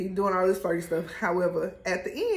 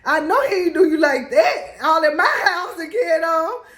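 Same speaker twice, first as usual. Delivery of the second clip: higher, louder indignant outburst; indignant friend; 6 semitones higher, 7 dB louder than usual. Retold or imitated. Kelsey ready to fight over the coach, loud and indignant. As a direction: bristle with outrage on her behalf.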